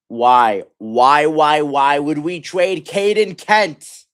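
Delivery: loud, slow, exasperated demand; outraged shout. Real, not acted. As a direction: hurl the question at the front office. Fan outraged at the trade.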